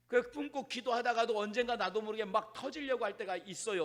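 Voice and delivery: ordinary talk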